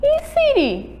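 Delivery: "E siri" pitched very high; high teacher's call of "E siri"; final syllable stressed. Shown as a demonstration. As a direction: introduce "E siri" brightly high, landing on RI. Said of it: "A sílaba tônica é o".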